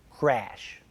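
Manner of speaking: usual speaking voice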